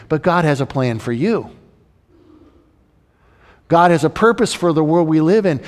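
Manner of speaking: usual speaking voice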